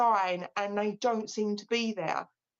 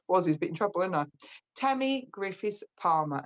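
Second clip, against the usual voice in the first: ordinary talk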